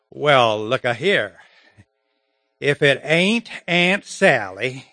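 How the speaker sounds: big, drawn-out Southern accent; Deep South accent. Shown as a demonstration. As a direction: welcome kin in a broad old Southern accent. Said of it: "The old South, right? Deep South".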